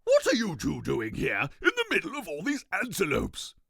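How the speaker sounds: a grand king greeting; pompous king. Acted. King Toothbrush Weasel voiced.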